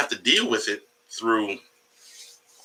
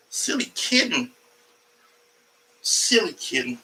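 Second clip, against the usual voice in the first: pet voice; 5 semitones higher, 4 dB quieter than usual. Real, not acted. Talking to the cat.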